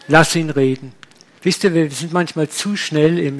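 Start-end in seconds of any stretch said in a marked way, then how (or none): none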